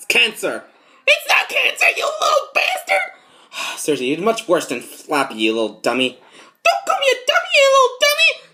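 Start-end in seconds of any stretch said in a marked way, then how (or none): 1.1-3.8 squeaky cartoon robber. Voiced character arguing back. 6.6-8.5 very high, loud squeaky villain yell; squeaky cartoon robber. Acted quarrel, squeaky voice.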